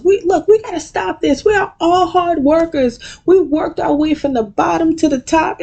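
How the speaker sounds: very high mock Quad voice; Quad impression. Quad urging the cast to stop, in a very high mock voice. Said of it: "Quad goes".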